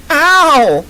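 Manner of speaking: high, loud yelp of pain; sharp squeal. Acted. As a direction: squeal as if pinched hard. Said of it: "Amber squealed".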